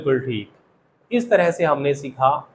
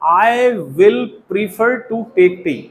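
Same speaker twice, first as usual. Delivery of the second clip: very slow, slightly raised dictation; slow model sentence; 3 semitones higher, much slower than usual. Shown as a demonstration. Teacher repeats the full example to students, slow and deliberate. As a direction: stretch every word for the class.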